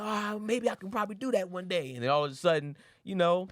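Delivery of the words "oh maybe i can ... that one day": higher mock girl voice. Her reply in a high put-on voice.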